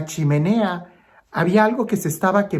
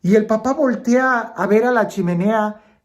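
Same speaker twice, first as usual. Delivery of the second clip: normal talking voice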